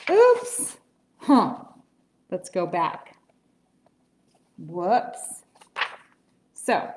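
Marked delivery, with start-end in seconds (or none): none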